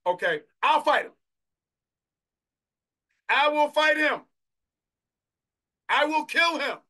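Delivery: loud, high battle declaration; preacher voicing David. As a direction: shout it out as a bold vow. Preacher voices David's boast.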